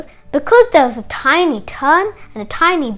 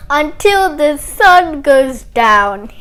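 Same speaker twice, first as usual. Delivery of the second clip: loud, slow drawn-out decree; gloating tortoise; 6 dB louder, much slower than usual. Acted. Tortoise orders Hare to keep polishing, slow gloating. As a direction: stretch out the order with relish. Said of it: "he said".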